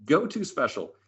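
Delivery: ordinary delivery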